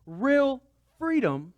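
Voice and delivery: real freedom, drawn out high and loud; emphasized again. Real, not acted. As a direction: really press real freedom, slow and strong. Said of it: "let me emphasize that".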